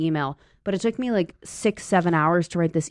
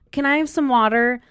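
put-on higher nagging kid voice; 8 semitones higher than usual. Kids pestering the babysitter, higher nagging voice. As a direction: rattle off demands like a needy child.

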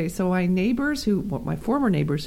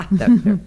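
sick witch laugh; 8 dB louder than usual. Acted. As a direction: cackle like a sick old witch. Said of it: "That's my sick witch laugh".

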